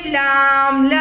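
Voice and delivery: لام then ل, spelled out loud and clear; letter sound demo. Shown as a demonstration. Teacher sounding out لام for children, clear demo. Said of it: "اور اس کی sound کیا ہوتی ہے؟".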